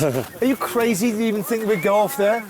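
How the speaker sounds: high, loud disbelief; can't believe he'd think that; incredulous. Real, not acted. Incredulous at friend's idea.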